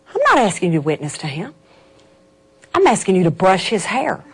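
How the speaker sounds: low, soft, calm voice of the Lord; voice of God. Retold or imitated. God speaking to her heart, low and calm.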